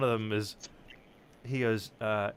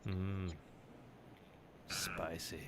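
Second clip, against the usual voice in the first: breathy whispered aside; 4 semitones lower, 14 dB quieter, much slower than usual. Real, not acted. Friend whispering a verdict on the wine choice. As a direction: whisper it to yourself. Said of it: "unvoiced whisper".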